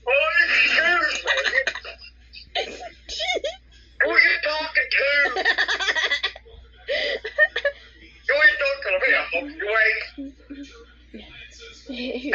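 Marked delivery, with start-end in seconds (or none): 0.1-10.0 mock Karen. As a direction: play an entitled customer, hammed up. Kid acting out a rude Karen.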